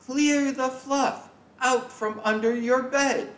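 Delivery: high motherly voice; a parent's high, insistent instruction; mom telling him off. Acted. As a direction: play the mother, pitched well up and firm. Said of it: "Mom says".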